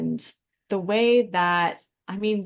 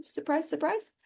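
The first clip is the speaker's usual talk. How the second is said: sarcastic aside; 10 semitones higher than usual. Real, not acted. Sarcastic aside on flawed books.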